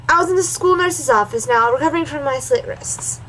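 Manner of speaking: usual speaking voice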